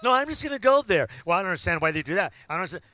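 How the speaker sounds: ordinary talk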